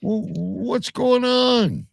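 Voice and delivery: high, drawn-out exasperated demand; demanding an explanation, pitched well up. Real, not acted. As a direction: stretch it out slowly in pleading disbelief. Confronting Stu over neglected allotment.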